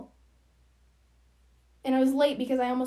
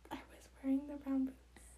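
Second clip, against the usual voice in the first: breathy whispered insistence, no voice at all; insisting to Mackenzie in a hushed whisper; 9 dB quieter than usual. Real, not acted. Insisting again to partner.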